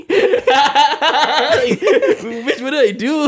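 laughing. Retold or imitated. Retold first kiss, laughing.

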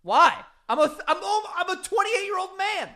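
high, loud comic protest; exasperated self-mockery. Real, not acted. Grown man questioning his fake sleeping, exasperated shouting. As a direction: cry out in baffled indignation. Mock outrage at own silly habit.

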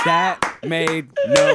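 high, slow words through laughter; breaking up with real laughter; laughing disbelief. Real, not acted. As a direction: squeeze it out while cracking up. Cracking up at Biden clip.

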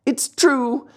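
high-pitched wail, climbing on the repeat; acted tearful cry. Puppet admits theft to the glowworm, tearful high wail. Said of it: "cried Pinocchio in tears".

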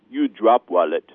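imitating the thief's broken-English foreign accent; flat put-on foreign accent. Pickpocket girl claiming he dropped it, mock foreign accent. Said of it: "she says".

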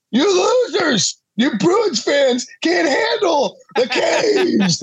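high, slow mock yell at Boston fans; a fan trash-talking Bruins fans on the radio, mock shouting. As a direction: taunt rival fans in a hammed-up voice. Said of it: "I do Boston radio hits and be like".

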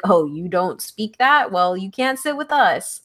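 ordinary talk

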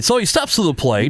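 pukey play-by-play announcer voice; baseball announcer. Acted. Host spoofing how announcers all sound, baseball play-by-play. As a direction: call it like a hammy baseball announcer. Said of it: "next on the list is baseball announcer".

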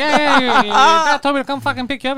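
put-on high, loud kid voice with a laugh; cocky kid. Boy driver explaining the dad sent him, high cocky kid voice.

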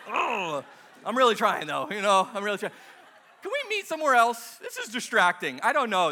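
loud, hammed-up exasperated pleading; flustered pleading. Man pleading with mind-reading Jesus, comically flustered. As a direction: plead loudly, rattled and distracted.

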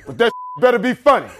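high mock warning; hammed-up messenger's warning; man relaying orders. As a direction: pitch up the threat, laughing. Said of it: "he goes".

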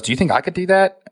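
lightly voiced friend, pitched high; high hopeful friend voice. Lindsay asking if she could do it too, hopeful and high. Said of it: "And she goes".

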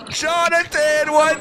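high, drawn-out name call; real excited greeting; hyped greeting shout. Real, not acted. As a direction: welcome the guest with a long high holler. Greets guest joining from arena.

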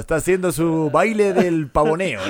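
slow Castilian nature narrator; Spanish documentary dub. Acted. Comedian describes a strutting male, Spanish documentary dub. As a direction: draw it out in a mock Spain-Spanish dub. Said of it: "odio el doblaje español".

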